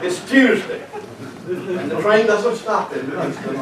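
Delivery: high, loud echo of the conductor's rule; loud punchline exclamation. Retold or imitated. Rescuer tells the passenger about the train, loud exclamation.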